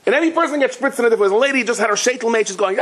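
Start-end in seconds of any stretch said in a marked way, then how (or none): none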